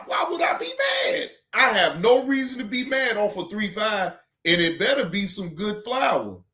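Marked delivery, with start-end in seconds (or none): 0.1-1.3 squealing why-would-I shout; incredulous yelp. Pretend. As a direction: shoot way up into a cracking yell of disbelief. Mock outrage at chat question.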